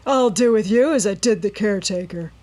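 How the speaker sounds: loud rough villain threat; menacing threat. A narrator's reading. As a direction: threaten her coldly. Robber threatening Nancy.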